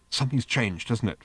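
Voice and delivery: plain reading voice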